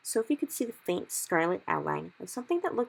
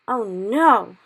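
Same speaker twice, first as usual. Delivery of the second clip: loud dismayed cry; cried; 5 semitones higher, 14 dB louder than usual. Acted. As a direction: cry out in alarm. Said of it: "he cried".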